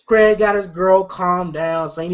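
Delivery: ordinary talk, nothing marked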